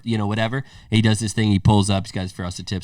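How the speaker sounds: usual speaking voice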